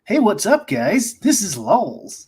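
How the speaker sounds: slow, high announcer intro; show intro voice. Shown as a demonstration. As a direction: greet the audience like a host. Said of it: "How's that?".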